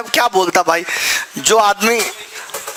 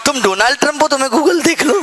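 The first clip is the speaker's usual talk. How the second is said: indignant retelling; 6 semitones higher than usual. Retold or imitated. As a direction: quote the insult back in outrage. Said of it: "मुझे इतनी गुस्सा आई यार". (गुस्से में दोहराया गया ताना)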